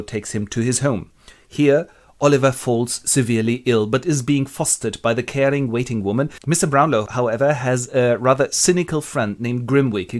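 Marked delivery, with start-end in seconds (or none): none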